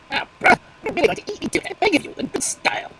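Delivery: pleading crow. Acted. Crow caught by the fox, pleading. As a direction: beg the fox to kill you in style.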